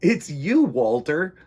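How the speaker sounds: a startled penguin's mild gasp of recognition; slightly startled. Acted. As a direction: jump a bit at the voice, then recognize your friend. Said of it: "slightly startled".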